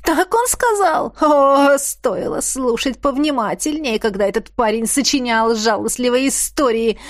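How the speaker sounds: high, cold taunting witch; mocking witch voice. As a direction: sneer like an unhinged witch. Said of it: "Холодный и истеричный".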